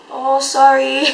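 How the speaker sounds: high, loud drawn-out sorry; imitated singer's apology. Imitating [name] answering a yelling fan.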